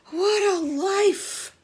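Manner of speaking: slow, high contented coo. Acted. Al cooing to Eddie about island life, slow and blissful. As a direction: coo it out, savouring paradise. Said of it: "cooed Al".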